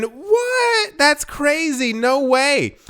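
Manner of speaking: high, stunned cry of disbelief; shocked disbelief. Real, not acted. Vlogger stunned by a crypto headline, high shocked disbelief. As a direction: gasp at the headline, can't believe it.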